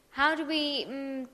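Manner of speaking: ordinary delivery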